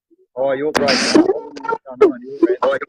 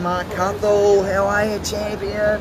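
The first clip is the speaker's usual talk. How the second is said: higher and slow, stretched-out hello to a mate; excited greeting; 7 semitones higher, slower than usual. Real, not acted. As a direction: call out to the champion warmly and drawn out. Greeting a viewer on live.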